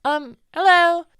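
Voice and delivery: high, slow, loud hello; high character greeting. Acted. Dangling player greets the stranger in a high voice. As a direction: call up a sweet hello from upside down.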